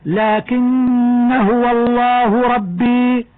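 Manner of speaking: very slow tartil chant; slow chanted recitation; Quran recitation. Shown as a demonstration. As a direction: recite slowly and melodically. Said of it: "من قوله تعالى".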